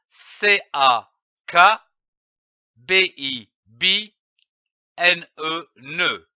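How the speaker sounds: "C A K B I B N E, ne" letter by letter, each syllable then blended; spelled out by syllable. Shown as a demonstration. Teacher breaking down the phrase for learners, spelling syllable by syllable. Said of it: "Commençons par lire les syllabes".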